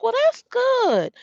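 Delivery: high, drawn-out approval; approving. Retold or imitated. Praising an old man for going to church, high and approving.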